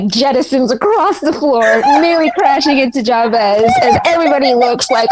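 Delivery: ordinary talk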